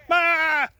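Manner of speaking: sudden high, loud yelp; shout-out yell. Pretend. Giving a fan his shout-out with a sudden high, loud yell.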